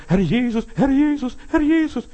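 loud, very high repeated calls; mock crying out. People shouting "Lord, Lord" to be heard, escalating high-pitched cries. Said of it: "Herr schreien".

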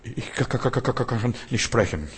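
a lightly acted stammer on ka; mock stutter. Preacher mimicking a believer stuttering in a light mock stammer. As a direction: stammer on the word kann before getting it out. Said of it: "Und dann stotterst du".

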